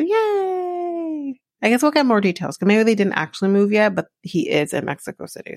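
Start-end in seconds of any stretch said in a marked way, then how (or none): 0.0-1.4 sarcastic yay. Real, not acted. Sarcastic cheer over couple's news.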